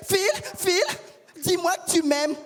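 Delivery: high, lightly mimicked needy girlfriend; a girlfriend waking her man at night, high and needy; pleading girlfriend. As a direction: whine for reassurance like a clingy lover.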